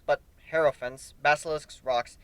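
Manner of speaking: ordinary delivery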